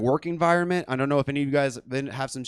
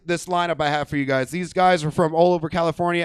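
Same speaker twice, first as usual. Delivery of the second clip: ordinary delivery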